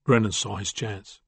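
ordinary delivery

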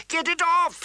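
high, loud scream of pain. Acted. Prince screaming as the wolf mauls his arm. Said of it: "he screamed".

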